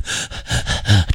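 short breathy panting, no words; gasping for breath. Retold or imitated. Driver reliving a crawl through dust, mimed gasping. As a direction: imitate gulping for air.

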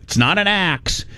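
usual speaking voice

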